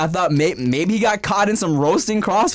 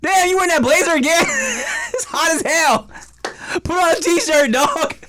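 mock roasting; 11 semitones higher than usual. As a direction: rib a friend about his blazer. Roasting a friend about his blazer.

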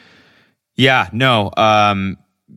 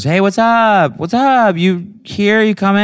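high, loud, breezy friend voice; high-pitched, oblivious "hey, what's up"; Bridget on the phone; 13 semitones higher, 5 dB louder than usual. Retold or imitated. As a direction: mimic a friend's perky phone greeting. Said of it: "She goes".

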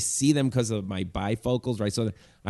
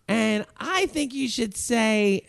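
put-on high, slow drunk-girl voice; stretched-out, high mock heckling; drunk heckler; 10 semitones higher, slower than usual. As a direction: imitate a drunk girl butting in. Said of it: "girl in the corner who's drunk".

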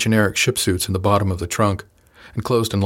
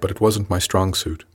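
plain reading voice

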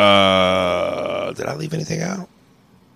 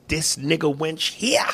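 slow, higher-pitched menace; threatening movie line; 6 semitones higher, 7 dB quieter, slower than usual. Shown as a demonstration. Host shows co-host the villain's line, slow menace. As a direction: draw it out, rising on the last word. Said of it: "The scene is him threatening".

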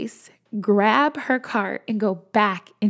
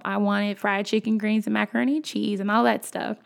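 ordinary talk

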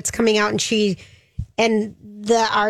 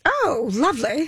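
put-on British accent; 3 semitones higher than usual. Echoing the imitated British child.

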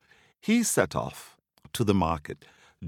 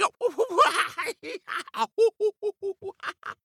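high, gleeful burst of laughter; delighted laugh. Real, not acted. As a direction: laugh hard at the monkeys' antics. Monkeys screeching and screaming.

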